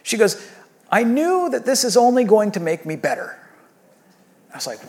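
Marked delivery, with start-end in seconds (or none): 0.0-3.4 daughter's voice. Retold or imitated. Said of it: "And she's like".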